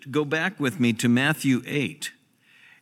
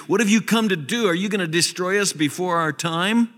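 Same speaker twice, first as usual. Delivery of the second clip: demons crying out; 8 semitones higher than usual. Retold or imitated. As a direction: shout it fast like panicked demons. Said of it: "they cried out".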